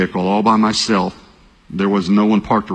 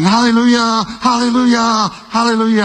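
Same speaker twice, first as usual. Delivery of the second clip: shouted hallelujah; 14 semitones higher, 3 dB louder than usual. Retold or imitated. Pastor reliving his answered prayer, loud high shout. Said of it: "I'm shouting".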